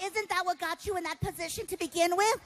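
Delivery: high, doubting audience voice; sceptical challenge. Acted.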